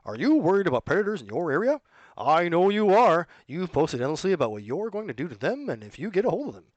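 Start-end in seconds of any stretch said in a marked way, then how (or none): 0.1-3.3 mild infomercial salesman pitch. Acted. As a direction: lightly sell it like a late-night ad. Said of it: "Like a T V ad salesman".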